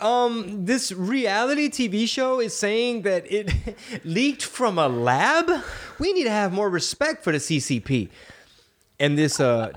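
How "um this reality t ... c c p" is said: high, slow sneering newscaster voice; mock news anchor. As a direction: draw out the words like a smug anchor. Mocking mainstream media voice.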